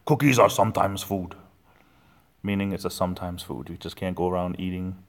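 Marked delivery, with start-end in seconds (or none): 0.0-1.3 louder monster voice, leaning on cookies. Retold or imitated. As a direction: boom it like the muppet. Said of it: "he says".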